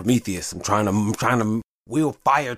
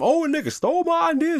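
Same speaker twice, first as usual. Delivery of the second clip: high mocking whine of a complainer; mock someone crying stolen idea, pitched way up; mocking whiner. Host mocking people who claim he stole ideas, high mock whine. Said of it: "And now some".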